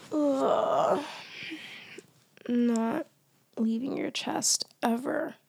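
ordinary talk, nothing marked

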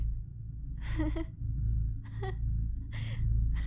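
airy unvoiced laugh, rising; a breathy nervous chuckle. Nervous laugh before confession.

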